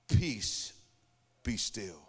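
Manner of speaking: low, hushed calming command; calm voice of Jesus. Retold or imitated. Jesus speaking to the storm, low and hushed. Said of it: "Jesus spoke three words".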